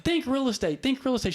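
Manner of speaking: retelling an eager burst of inspiration; excited pitch. Retold or imitated. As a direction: sell the name to your wife with rising excitement. Pitching name to wife excitedly.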